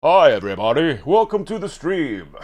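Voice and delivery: hammed-up host welcome; mock streamer intro.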